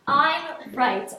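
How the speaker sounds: ordinary delivery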